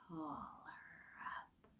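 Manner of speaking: slow ghostly whisper; gray voice whisper. Acted. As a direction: breathe it out like a cold spirit. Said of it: "said the gray voice".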